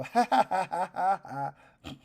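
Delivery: high, loud taunting little laugh; pixie. Acted. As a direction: cackle like a tiny mischievous pixie. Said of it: "you hear a little voice go".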